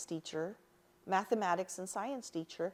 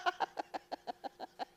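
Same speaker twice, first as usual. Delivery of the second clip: 1 real laugh; 11 semitones higher, 4 dB quieter than usual. Real, not acted. Laughing at her own remark.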